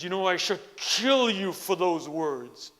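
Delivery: slow, loud menacing threat; furious samurai. Retold or imitated. Angry samurai threatens the Zen master, loud and slow. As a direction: growl it out, hand on the sword.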